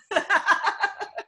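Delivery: high, loud burst of laughing; laughter. Real, not acted. Host laughing at the funniest frozen face, laughter. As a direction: laugh out loud, really amused.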